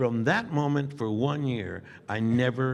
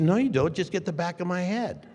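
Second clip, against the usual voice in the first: defiant; 7 semitones higher than usual. Retold or imitated. Retort to directors.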